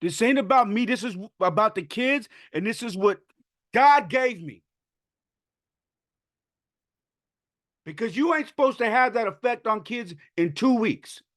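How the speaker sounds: high, loud, choked-up cry of disbelief; real tearful outburst. Real, not acted. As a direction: let the words burst out through tears, pitch way up. Overcome retelling kids' reaction.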